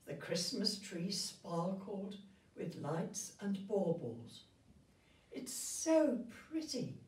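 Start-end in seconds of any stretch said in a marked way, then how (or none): 5.3-7.0 put-on high, delighted little girl gasp; excited girl. Marie admiring the sparkling Christmas tree, delighted. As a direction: cry it out in wonder at the tree. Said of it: "cried Marie".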